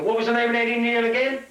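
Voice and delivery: high, loud comic yell; hammed-up high-pitched shout. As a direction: holler it to someone behind a shut door. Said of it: "he shouts out to the instructor".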